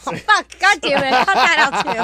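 laughing. Real, not acted. Laughing fit while recording.

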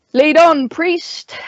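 loud, firm order; commanding. Acted. Owl orders the priest forward, loud and firm. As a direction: snap out a command to end the standoff. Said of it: "the owl commanded".

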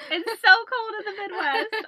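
high, loud laughing complaint. Real, not acted. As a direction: laugh through the words. Laughing about cold.

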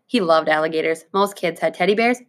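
plain reading voice

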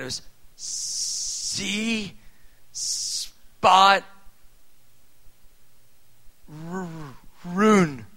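sounding out "see Spot r- rune" haltingly, stretching the letters; a child stumbling over "rune", drawn out; imitating a beginner reader; slow halting misreading. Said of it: "it wasn't even like that".

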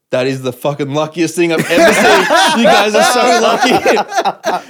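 loud, high imitation through laughter, stretching the end; amazed disbelief. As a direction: retell a friend's astonishment, voice climbing into a laugh. Acts out Dan's amazement after escape.

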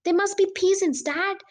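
high, urgent exclamation; excited cry. A narrator's reading. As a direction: call to Dad in excitement. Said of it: "I cried".

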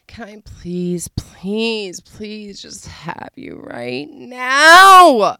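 whispered pleas building to a loud wail; slow hammed-up begging, last word stretched and high. Shown as a demonstration. Showing how to beg a lover, breathy whisper rising to a wail. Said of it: "you're begging him".

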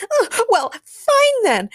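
put-on very high, loud girlish outburst; huffy shout. A narrator's reading. Kara storms off after being dismissed, enraged shout. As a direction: shout it in a rage. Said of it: "Kara Danvers shouted as she ran".

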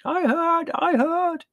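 very high, loud answering call; shepherd's wife, high-pitched reply; woman calling from indoors. A narrator's reading. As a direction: shout back from behind shutters. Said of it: "came a muffled voice from behind the shutters".

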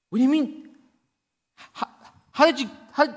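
loud, high, stuttering bafflement; confused angel. Acted. Angel baffled by how the thief got in, confused. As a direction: stumble over the question, utterly thrown. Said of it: "all the angels all confused".

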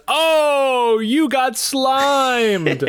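high, very slow, sing-song mock gloating; Sander Cohen voice. [name] voices Sander Cohen gloating over a victim, high and very slow. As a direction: stretch it out high like a gleeful game-show host. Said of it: "he goes".

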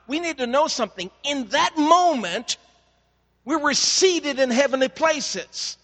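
climactic sermon delivery, pitched high and emphatic; full-force preaching. Acted.

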